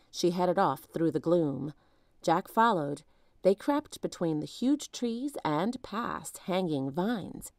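plain reading voice

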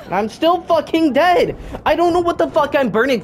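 high, loud outraged rant; furious yell. Real, not acted. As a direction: shout it in angry disbelief. Dies again unexpectedly.

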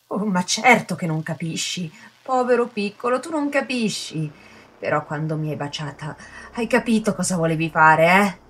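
sarcastic taunt rising high, voice cracking; mock him as a poor little boy; shrill sneering pity breaking into tears; mocking and tearful. A narrator's reading. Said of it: "La sua voce si incrinò".

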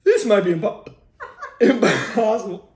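slow, laughing through the burn; laughing in pain. Real, not acted. Mouth burning from spicy chip.

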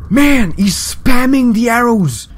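high, loud complaint; exclaiming in protest. Real, not acted. Player under a hail of arrows, loud high protest. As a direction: cry out at the unfair attack. Complains during game fight.

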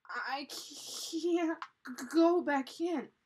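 loud, high, slow stammer from cold; shivering. A narrator's reading. Annie out of the icy stream, shivering. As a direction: stutter through chattering shivers.

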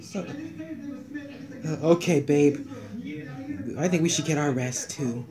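very low, soft murmur; subdued. Acted. Telling babe it's time to rest, low murmur. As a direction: murmur it low to your partner. Couple turning in for night.

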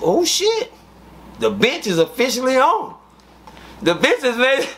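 high, rising, thrilled outburst; excited exclamation. Retold or imitated. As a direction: cheer that the bench is now on. Excited exclamation after fight.

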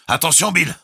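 high, loud, ringing warning; warning cry. A narrator's reading. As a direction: shout a warning across the engine room. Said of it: "Son cri résonna". (cri d'avertissement)

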